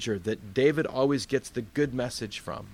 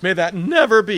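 loud, high, dramatic protest; vehement objection; 7 semitones higher, 12 dB louder than usual. Acted. Peter objects to Jesus's coming death, vehement shout. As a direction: boom it out, refusing outright.

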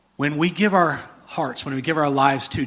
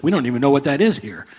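normal talking voice

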